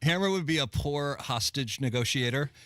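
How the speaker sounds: usual speaking voice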